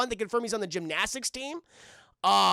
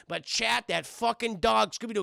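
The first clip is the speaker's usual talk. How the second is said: usual speaking voice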